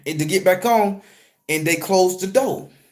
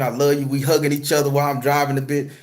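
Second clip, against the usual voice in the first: ordinary delivery, nothing marked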